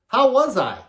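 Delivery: loud, high, wide-eyed asking; eager question. Retold or imitated. Ace to the monitor mixer after forgetting the show, eager. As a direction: imitate a clueless star keen for the verdict.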